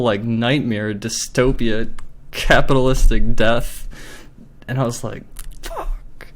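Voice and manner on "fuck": voiceless, breath-only swear; whispered curse. Retold or imitated. Customer retelling leaving the store furious, whispered curse. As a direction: whisper it as a retold reaction.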